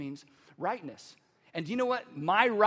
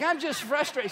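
raised, louder retold objection; mock protest; 6 semitones higher, 6 dB louder than usual. Client replaying his denial to counselor Steve, heightened mock protest. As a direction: insist on it, pitched up in protest.

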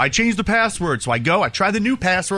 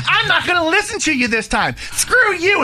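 hammed-up furious mother on the phone; angry mom yelling; 7 semitones higher than usual. Mom yelling in retold call.

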